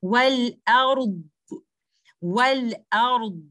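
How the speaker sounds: "Wal ard" ending on a held, muffled dad; dad with rakhawa. Shown as a demonstration. Teacher shows stopping at letter dad, "Wal ard" with the final dad given time. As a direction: stop on the dad of "Wal ard", keep it inside without bouncing. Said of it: "I should be saying it like this".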